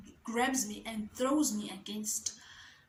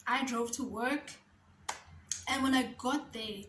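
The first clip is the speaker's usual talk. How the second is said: usual speaking voice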